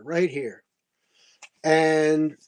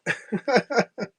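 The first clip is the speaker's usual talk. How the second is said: laughing; 6 semitones higher than usual. Real, not acted. Laughing at his own offer.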